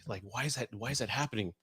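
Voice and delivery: hushed, puzzled talk to oneself; baffled self-questioning. Retold or imitated. Man asking himself why he gets emotional, hushed and baffled. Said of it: "I'm like".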